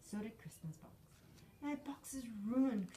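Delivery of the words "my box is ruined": mock sob in a breathy hush; a child's lightly acted wail; sobbing. A narrator's reading. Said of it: "Crispin sobbed".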